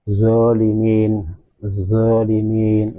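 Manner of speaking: zolimin drawn out slowly, heavy zo; teacher demonstrating ẓā; slow emphatic zo. Shown as a demonstration. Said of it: "Sementara zo".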